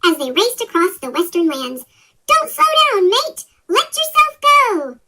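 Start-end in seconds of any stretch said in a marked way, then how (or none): 2.3-5.0 much higher and louder, urging him on; eager shout. Acted. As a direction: yell it like a racer egging on a friend. Ace urges Thomas to speed.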